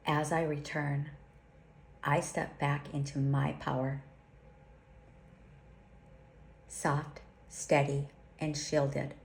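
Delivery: slow, solemn prayer with long pauses; slow invocation. Acted. Teacher leads listeners through a protection invocation, slowly and solemnly. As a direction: intone each line like a sacred vow. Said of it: "I'll say it slowly".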